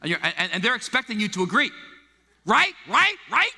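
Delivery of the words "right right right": high, loud pressing for agreement; eager agreement seeker. Retold or imitated. Angry friend pressing the pastor to agree. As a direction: push them to agree, pitched up high.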